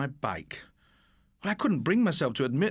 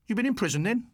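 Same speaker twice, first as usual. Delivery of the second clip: pushy high challenge, raised; demanding; 8 semitones higher, faster than usual. Acted. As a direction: taunt him, demanding an answer. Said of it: "Morris demanded".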